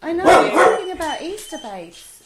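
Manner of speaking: high, loud sing-song talk to a dog; pet talk. Real, not acted. Host talks to her dog mid-podcast, high sing-song. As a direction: coo up high at the dog, loudly.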